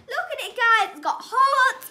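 loud, bubbly, laughing; excited showing off. Real, not acted. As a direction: show the camera, thrilled. Showing off bracelet.

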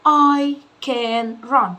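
slow teaching demo, word by word. Shown as a demonstration. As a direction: spell it out slowly for a child. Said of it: "بمشي كلمة كلمة معاكم".

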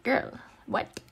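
ordinary delivery

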